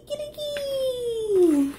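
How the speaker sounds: high, slow drawn-out kikiriki; rooster crow. Shown as a demonstration. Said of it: "qué dice el gallo".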